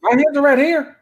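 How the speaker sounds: squeaky, high-pitched protest; high defensive voice. Real, not acted.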